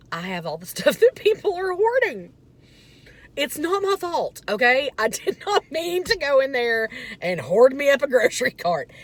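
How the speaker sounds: high, loud, laughing through the words; laughing disbelief. Real, not acted. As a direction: crack up at your own cart. Realizing she looks like a hoarder.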